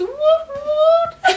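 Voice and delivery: high squealing woot; excited cheer. Real, not acted. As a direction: cheer it out, thrilled. Excited celebration cry.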